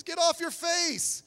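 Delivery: loud, high, drawn-out order; divine command. Acted. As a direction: call it out forcefully as God's order. Quotes God's command again.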